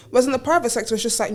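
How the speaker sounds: ordinary talk, nothing marked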